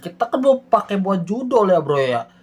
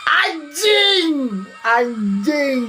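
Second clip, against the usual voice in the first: real frustrated shout, higher; frustrated curse; 8 semitones higher, much slower than usual. Real, not acted. Gamer cursing at the forced slap choice, shouting. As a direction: blurt it out in disbelief.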